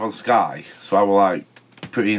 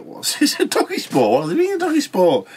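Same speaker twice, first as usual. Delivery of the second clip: incredulous. Retold or imitated. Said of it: "I'm like".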